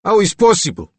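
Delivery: loud, high Slavic snap. Acted. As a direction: snap it angrily in a Slavic accent. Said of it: "Regan snapped".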